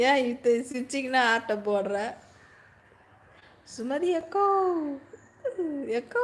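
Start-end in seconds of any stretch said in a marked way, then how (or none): 3.7-6.3 calling out. Real, not acted. Host hailing an akka on a live stream, calling out. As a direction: stretch the last vowel upward, hailing someone.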